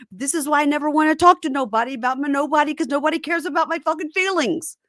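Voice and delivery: worked-up, insistent plea to be heard; exasperated hurt outburst. Acted. Retelling a partner's plea to be listened to, exasperated. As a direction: rush it out, rising in pitch with frustration.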